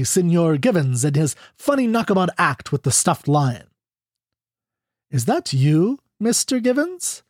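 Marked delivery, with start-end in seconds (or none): 5.1-7.2 lightly sugared question, lifting high at the end; understated saccharine woman's voice, rising on the name; deliberate saccharine. A narrator's reading. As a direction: ask with a touch of false sweetness. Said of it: "in her deliberate saccharine contralto".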